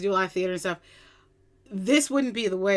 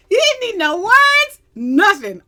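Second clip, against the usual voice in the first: high, loud, stretched-out amazement; excited raving; 12 semitones higher, 12 dB louder, slower than usual. Real, not acted. Fan raving that he didn't need words, excited. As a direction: exclaim it slowly, overwhelmed with admiration.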